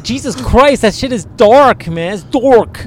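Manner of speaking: high, loud, slowed amazement; amazed. Retold or imitated. Friend recalling seeing the hickey, high and amazed. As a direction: dwell on how dark it is. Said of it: "I was like".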